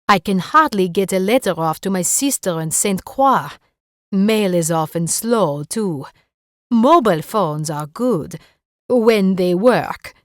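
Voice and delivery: put-on light French accent, wry and chatty. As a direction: complain cheerfully with a French lilt. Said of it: "in a thick French accent".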